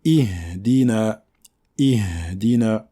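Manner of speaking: "Ihdina" drawn out on a clear initial I; full I vowel. Shown as a demonstration. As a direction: say "Ihdina" slowly, starting with a full I. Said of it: "Il faut bien prononcer la lettre I".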